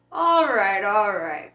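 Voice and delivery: loud irritated snap; snapped. Acted. Crowley snapping to stop the angel's compliment, loud and irritated. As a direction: cut him off, loud and annoyed. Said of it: "Crowley snapped".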